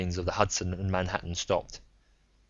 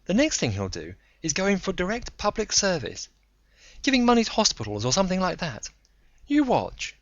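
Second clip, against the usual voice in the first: higher, knowing woman's voice; Joan's knowing voice; 11 semitones higher than usual. Acted. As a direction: voice her like she saw it coming. Joan quoted by narrator.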